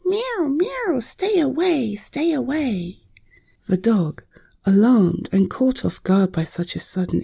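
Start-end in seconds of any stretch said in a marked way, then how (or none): 0.0-1.0 very high mewing cat voice; cat meowing. A narrator's reading. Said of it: "hissed and spat and said". 1.0-1.9 high hissing cat warning. A narrator's reading. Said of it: "hissed and spat and said". 1.9-3.0 high, slow drawn-out cat warning; slower cat warning. A narrator's reading. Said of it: "hissed and spat and said".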